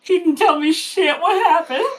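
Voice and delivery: high, loud laughing disbelief; shocked laughing. Real, not acted. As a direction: blurt it through a laugh.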